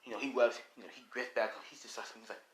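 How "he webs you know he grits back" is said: ordinary delivery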